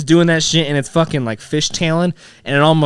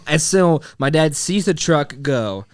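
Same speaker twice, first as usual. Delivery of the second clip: ordinary talk, nothing marked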